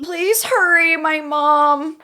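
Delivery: put-on high, pleading frightened girl; 911 caller. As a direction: beg for help, panicked. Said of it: "I am going to be Melissa".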